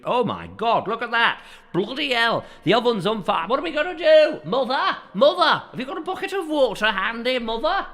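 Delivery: high, loud comic alarm; hammed-up panic. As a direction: cry out for help in mock horror. Acted panic at burning bakery.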